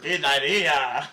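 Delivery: slow, laughing mock Spanish; mock Spanish accent. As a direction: stretch it out through laughter.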